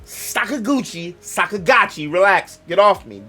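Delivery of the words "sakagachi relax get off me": ordinary talk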